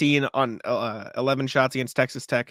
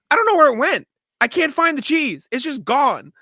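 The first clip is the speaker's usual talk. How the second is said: much higher and louder, incredulous; exasperated disbelief; 12 semitones higher, 7 dB louder than usual. Real, not acted. Dog owner ranting to cohost about lost cheese, exasperated high voice. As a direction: throw your hands up over the missing cheese.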